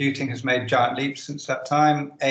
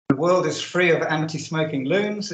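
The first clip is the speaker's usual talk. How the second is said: ordinary talk, nothing marked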